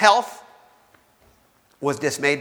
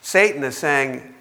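usual speaking voice

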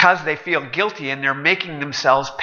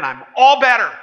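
higher, louder happy claim; proud boast; 8 semitones higher, 5 dB louder than usual. Acted. Customer boasting to the waiter he's cured, excited. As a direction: announce it proudly.

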